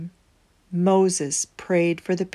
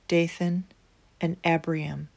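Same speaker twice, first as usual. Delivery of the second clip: plain reading voice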